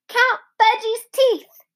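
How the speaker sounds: put-on very high, slow toddler plea. A narrator's reading. Fudge asking the dentist again, very high and slow. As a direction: draw it out like a small child.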